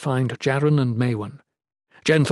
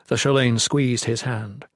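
usual speaking voice

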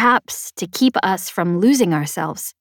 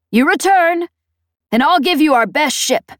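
higher, stern commander tone; commanding officer voice; 8 semitones higher, 5 dB louder than usual. A narrator's reading. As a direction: speak like a firm squadron commander. Peg's voice in the story.

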